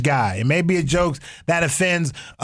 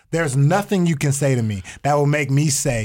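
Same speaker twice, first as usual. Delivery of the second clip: ordinary talk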